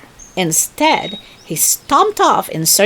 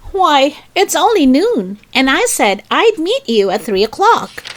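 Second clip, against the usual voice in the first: indignant Rabbit; 7 semitones higher, 3 dB louder than usual. A narrator's reading.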